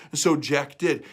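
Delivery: plain reading voice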